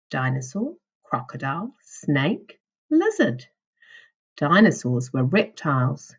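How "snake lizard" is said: usual speaking voice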